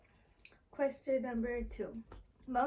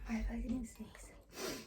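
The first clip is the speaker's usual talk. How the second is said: slow hushed whisper, softer; 6 dB quieter, slower than usual. Real, not acted. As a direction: whisper it under your breath. Whispered aside about sneezing.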